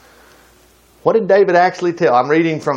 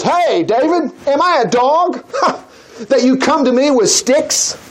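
a touch of dopey giant, laughing; lightly voiced taunting giant; dopey cartoon bellow; 6 semitones higher than usual. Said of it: "So the giant says".

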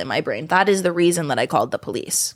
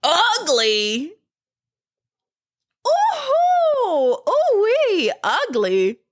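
high squealing ooh-wee; very high whooping mock outrage; mock shock; 12 semitones higher, much slower than usual. Real, not acted. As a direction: shriek in mock disbelief. Reacting to insult.

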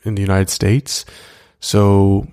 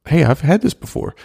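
surprised recognition; 8 semitones higher than usual. Retold or imitated. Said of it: "once I did meet my wife".

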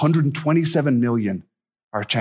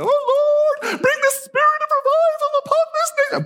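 hammed-up, very high wailing prayer; prayer lady. Prayer lady in church praying for revival, hammed-up fervour. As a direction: impersonate an over-fervent church lady praying at sky-high pitch. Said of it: "y'all know prayer lady".

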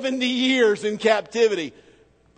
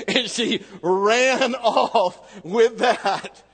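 laughing; 4 semitones higher, much slower than usual. Real, not acted. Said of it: "still laughing".

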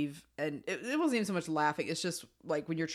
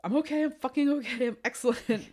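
high, loud forced okay; manic insistence; 7 semitones higher, 4 dB louder than usual. Retold or imitated. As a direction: brittle brave face. Claire insisting while mascara runs.

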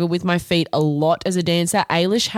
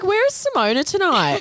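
high, laughing puzzled question; mock puzzled question; 10 semitones higher than usual. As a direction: ask it with a laugh. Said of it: "I was like".

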